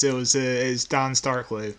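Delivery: normal talking voice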